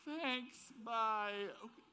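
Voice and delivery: high, lightly voiced nervous thanks; a hint of a startled girlfriend backing away; awkward quick exit. Girlfriend leaving the boutique owner, high, lightly voiced awkward goodbye. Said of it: "Chrissy's like".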